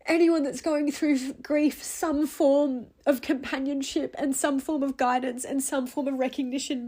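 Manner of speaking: ordinary talk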